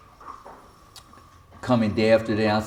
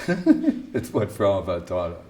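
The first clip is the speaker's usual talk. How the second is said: mocking the answer with a laugh, a bit lower; mock repeat; 4 semitones lower than usual. Teacher mocking a student's stock answer, teasing laugh. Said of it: "Like, that's a good answer".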